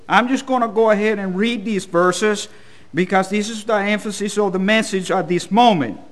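ordinary talk, nothing marked

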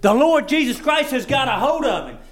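ordinary talk

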